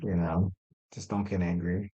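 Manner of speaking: usual speaking voice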